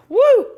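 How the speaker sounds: high, loud "woo"; big excited holler; delighted whoop. Real, not acted. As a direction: whoop it out, pitch leaping up.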